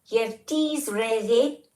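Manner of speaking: high, slow, drawn-out Scottish accent; awful Scottish accent. Shown as a demonstration. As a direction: stretch it out in a bad Scottish accent. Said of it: "awful accents".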